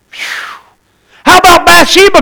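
ordinary talk

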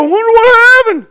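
exaggerated puzzled wail, poking fun; mock wondering. Mocking people who sit there wondering.

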